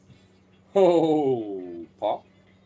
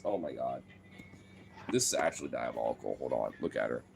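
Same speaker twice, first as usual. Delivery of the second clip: much lower and softer aside; hushed aside; 12 semitones lower, 10 dB quieter than usual. Real, not acted. Owner hushed at the dog's diabolical stare. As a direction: murmur it low so the dog won't hear. Dog gives a diabolical look.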